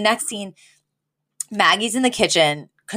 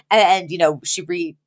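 ordinary talk

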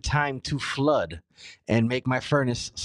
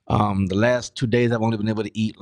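usual speaking voice

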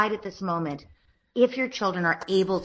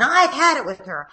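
put-on a touch louder, higher kid's complaint; mock yell; 6 semitones higher, 6 dB louder than usual. Son fed up with his sister, half-yelled complaint. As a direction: toss it out like a fed-up little boy. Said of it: "at this point he was yelling".

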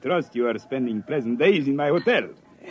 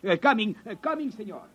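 much higher, hurried call back; hotel owner scrambling to answer; flustered reply; 9 semitones higher than usual. Acted. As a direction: sing out anxiously.

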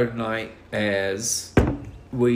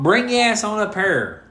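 higher, louder call to come up; 9 semitones higher, 5 dB louder than usual. Real, not acted. Telling the daughter on speakerphone to come on up, loudly. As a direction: holler it into the phone. Calling daughter home over phone.

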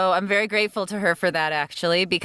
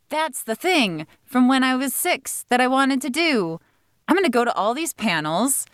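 retold eureka moment, pitched up; excited realization; 6 semitones higher than usual. High schooler discovering voiceover panels, retold excited resolve. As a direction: re-enact a sudden determined discovery. Retold teenage revelation at convention.

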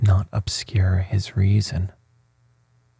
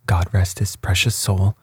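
plain reading voice

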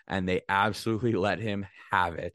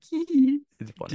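squawky mock voice; 4 dB louder, slower than usual. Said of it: "and I was like".

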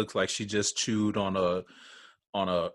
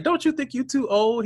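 mock incredulous question. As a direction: mimic them asking in disbelief. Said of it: "they was like".

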